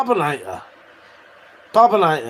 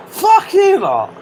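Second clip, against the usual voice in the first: much higher, slow, squeaky mock cry; mock squeaky outburst; 14 semitones higher, 5 dB louder, slower than usual. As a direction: shriek it at chat in mock offence.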